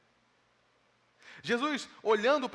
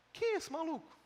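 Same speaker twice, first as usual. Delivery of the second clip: much higher, startled question; shocked onlooker; 8 semitones higher, 4 dB quieter than usual. Retold or imitated. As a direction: react like a bewildered bystander. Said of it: "as pessoas pensando isso".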